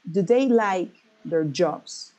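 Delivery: slow question, emphasis on "like" and "jobs"; "like" and "jobs" drawn out and stressed. Shown as a demonstration. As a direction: keep "Do" weak, stretch "like" and "jobs". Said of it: "only you put a stress in like and in jobs".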